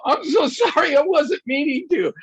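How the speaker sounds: high, loud flustered apology. Retold or imitated. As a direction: rush to apologize, embarrassed. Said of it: "I go".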